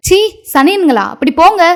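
put-on sharp, high shove-off at the kids; angry scolding.

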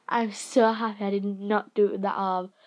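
ordinary talk, nothing marked

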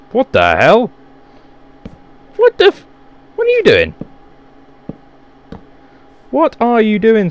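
high, loud what are you doing; shocked yell at an intruder; startled shout. Real, not acted. Startled by mob in house.

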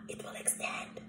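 breathy whispered aside. Acted. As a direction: whisper it to the class.

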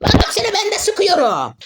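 sudden high furious shout at the enemy; frustrated yell. Real, not acted. Gamer yelling at opponent on stream, high furious shout. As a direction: blow up at the player who keeps shooting.

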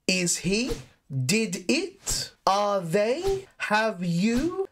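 each short question ends on a rise; curious rising question tags; rising intonation. Shown as a demonstration. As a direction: lift the tune up at the end of each question. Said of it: "make the intonation go up".

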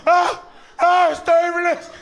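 high, loud panicked yelling; hammed-up panic; mock panic. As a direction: scream about turbulence like a terrified passenger. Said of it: "People gonna start freaking out".

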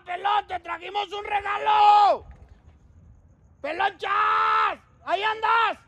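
high shouted greeting; calling out high. Real, not acted. Bringing a birthday cake, high calling shout. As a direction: shout up to the window.

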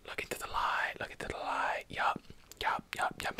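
usual speaking voice

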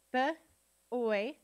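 B-oy broken into two sounds, b, then oy; sounded out. Shown as a demonstration. Teacher sounding out a word with students, B-oy split into sounds. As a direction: give B-oy as separate sounds with a pause. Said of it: "sound it out with me".

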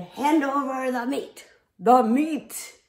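loud, rough pirate demand; pirate roar. Acted. Captain demanding meat at dinner, loud pirate roar. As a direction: bellow for the meat. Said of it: "and said".

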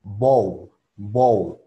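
"bow" as bowl, gliding O and dark L; model pronunciation. Shown as a demonstration. Teacher models the word for class, careful English "bow". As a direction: round the O into U, then a thick L on "bow". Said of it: "así se pronuncia".

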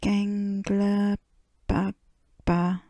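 put-on mild, drawn-out toddler call; toddler's shout. A narrator's reading. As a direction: call out like a little one, without much volume. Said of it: "shouted George".

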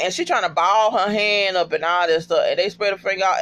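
normal talking voice

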